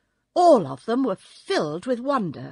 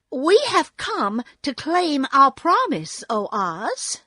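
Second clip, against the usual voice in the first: put-on slightly higher, louder girl's plea; firm, lightly voiced girl claiming a promise; 6 semitones higher, 5 dB louder than usual. A narrator's reading. Dorothy to Oz, claiming a promise, lightly voiced girl.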